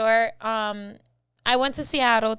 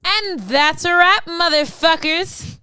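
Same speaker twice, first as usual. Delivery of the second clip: loud sign-off; 5 semitones higher, 7 dB louder, slower than usual. Real, not acted. Closing the episode.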